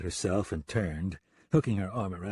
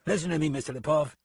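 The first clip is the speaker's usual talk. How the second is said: irritated, higher and quick; cross; 7 semitones higher, faster than usual. Acted. Deputy with a sore head to the gunman, cross. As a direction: warn him off crossly. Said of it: "Price said crossly".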